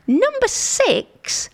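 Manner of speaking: "Number six" lifted high and slow. Real, not acted. Counting through the list, lifting to number six. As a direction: announce it high, slightly weary. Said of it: "I'm getting there".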